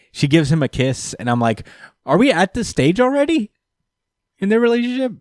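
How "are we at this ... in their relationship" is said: incredulous. Retold or imitated. Incredulous at sudden kiss.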